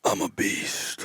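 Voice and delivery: slow, breathy monster growl; deep distorted monster roar. Shown as a demonstration. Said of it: "Should I do it again for the studio audience?".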